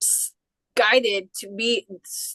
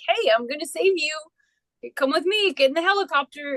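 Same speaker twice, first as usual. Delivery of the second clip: policeman rescuing; 6 semitones higher than usual. Retold or imitated. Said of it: "And he's like".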